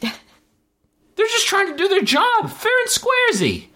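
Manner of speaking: very high, slow squeal of disbelief; mocking disbelief. Real, not acted. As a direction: squeak it out slowly, utterly incredulous.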